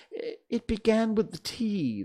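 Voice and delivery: high, timid reply; high-pitched anxious explanation; nervous Hatter. A narrator's reading. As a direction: answer the king nervously in a high voice. Frightened Hatter answers the king.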